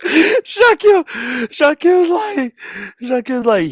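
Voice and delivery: high voice strained by laughter; squeaky, laugh-choked storytelling; cracking up. Real, not acted. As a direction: struggle to restart the line while laughing. Restarting story through his laughter.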